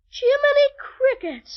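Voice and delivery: loud, high, drawn-out exclamation; amazed outburst. Acted. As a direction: cry out in slow, wide-eyed astonishment. Amazed at view through telescope.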